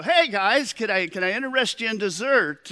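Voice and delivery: high, eager dessert pitch. Retold or imitated. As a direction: imitate the waiter bursting in with an offer. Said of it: "waiter comes rushing to the table".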